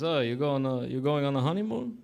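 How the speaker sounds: lightly imitated Russian-Italian accent; questioning in mock Russian accent. Barber asking groom about honeymoon, mock Russian accent. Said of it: "he's got this Russian accent".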